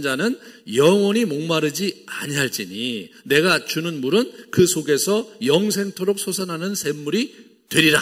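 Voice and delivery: solemn Bible reading voice; solemn scripture recitation. Acted. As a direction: proclaim the verse like holy writ. Preacher quotes Jesus from scripture.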